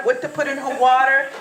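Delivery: usual speaking voice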